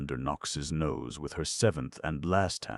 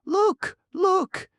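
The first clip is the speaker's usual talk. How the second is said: very high, loud squeal; excited cry; 12 dB louder than usual. Acted. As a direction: squeal it out in disbelief. Excited girl pointing at gnome.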